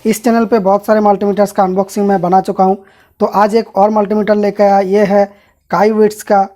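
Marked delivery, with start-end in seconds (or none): none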